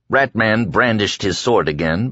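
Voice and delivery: ordinary delivery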